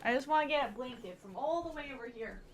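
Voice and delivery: normal talking voice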